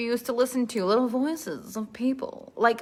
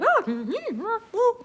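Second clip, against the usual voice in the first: high, slow exaggerated nodding sounds; drawn-out, high mm-hmms; overdone expressive reactions; 10 semitones higher, much slower than usual. Shown as a demonstration. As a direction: ham up every reaction. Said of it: "you're not gonna spend the whole story like".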